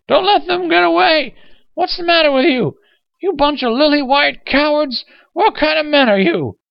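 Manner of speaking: high, loud raging shriek; furious screaming. Acted. Old man berates bystanders for doing nothing, screaming in fury. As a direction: berate them at the top of your voice. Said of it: "red with hate and fury".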